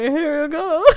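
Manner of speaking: high-pitched mock "here you go"; high, teasing imitation; mock cheery offering. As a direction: hand it over in a put-on high voice. Imitating David offering the drink.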